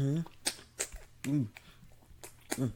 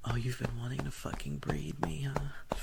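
whispering; 2 semitones higher than usual. Acted. Whispered roleplay.

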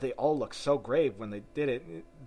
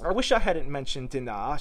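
mild, louder lament; rueful wish to oneself; melancholy; 3 semitones higher, 6 dB louder than usual. A narrator's reading. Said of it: "said to herself in a melancholy tone".

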